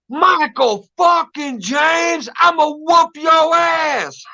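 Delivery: high, slow, drawn-out angry mom shout; furious mom yelling. Retold or imitated. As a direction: bellow his full name like a raging mother. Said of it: "she started cussing me out".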